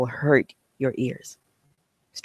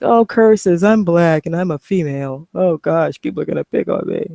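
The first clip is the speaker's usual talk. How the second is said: mock whiny victim; 3 semitones higher, 3 dB louder than usual. Mocking women who complain about race and gender, hammed-up whine. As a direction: parody a complaining woman, hammed-up. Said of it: "never have I been a woman that said".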